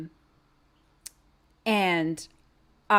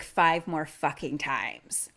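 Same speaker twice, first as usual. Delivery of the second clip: usual speaking voice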